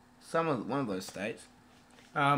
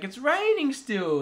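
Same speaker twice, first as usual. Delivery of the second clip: put-on slow, higher, louder kid's whine; whiny kid; 12 semitones higher, 7 dB louder, slower than usual. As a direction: complain like a sulky little boy. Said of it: "Billy's like".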